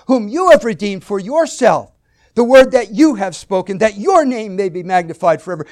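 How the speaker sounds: "your" and "you" hammered high and very loud; stress on YOUR and YOU. Shown as a demonstration. Preacher recapping how saturated David is with God, "your" and "you" hammered. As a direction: pound every "your" and "you". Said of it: "put emphasis on certain words".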